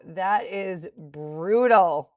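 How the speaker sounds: loud, slow, drawn-out dismay; real shock at a bad trade; dismayed exclaim. Real, not acted. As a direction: groan the words out at the loss. Reacting to a losing trade.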